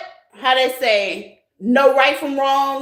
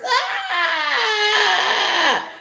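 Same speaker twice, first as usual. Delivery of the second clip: sudden high squeal; high shriek of laughter; 8 semitones higher than usual. Real, not acted. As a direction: let out a delighted shriek. Outburst amid laughter.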